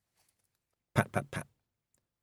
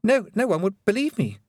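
higher, frightened stammer; 12 semitones higher, 3 dB louder than usual. A narrator's reading. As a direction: stammer it, voice up in fear. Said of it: "he stammered".

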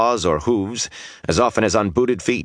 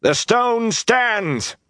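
high, slow, ringing chant-like shout; shouted battle cry; much slower than usual. Acted. Rand rallies defenders against twenty Trollocs, shouting battle cries. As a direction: shout it long and high to rally fighters. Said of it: "Those were the battle cries".

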